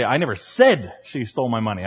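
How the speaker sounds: "said" singled out, hinting it was only implied; stress on SAID. Shown as a demonstration. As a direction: punch "said", the rest falls away. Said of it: "Or maybe in a third case".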